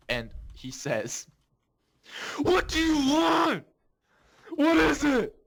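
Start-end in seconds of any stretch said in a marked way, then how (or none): 2.4-5.3 high crying shout, slowed, far above his own voice; whiny yell. Retold or imitated. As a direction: wail it out like a cornered kid. Osa confronted by bully, acted.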